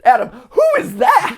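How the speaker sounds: high, loud imitation of a shocked wife; mock shriek. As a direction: shriek it in her voice. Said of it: "repeating the wife's shriek".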